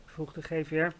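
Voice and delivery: ordinary delivery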